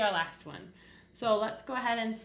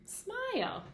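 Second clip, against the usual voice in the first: "Smile" said much higher, falling; cheerful high read; 12 semitones higher than usual. Shown as a demonstration. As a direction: sing out the finished word "Smile". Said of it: "Give me a big smile".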